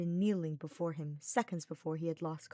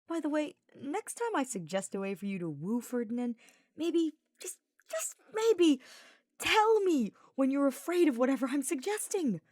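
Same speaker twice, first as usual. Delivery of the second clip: exasperated, voice climbing higher; huffy complaint; 8 semitones higher, 6 dB louder than usual. Acted. Sylvain scolding Hubert after the climb, huffy and exasperated. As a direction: huff it out, fed up with Hubert. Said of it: "the knight huffed".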